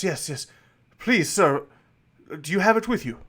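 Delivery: begging to see it now; eager. Acted. Noble host pleading with the adventurer, eager. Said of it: "He goes".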